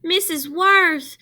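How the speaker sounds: high, loud, slow call; crying out. A narrator's reading. Jamaica crying out to her teacher, high and loud. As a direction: wail for the teacher. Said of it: "Jamaica cried".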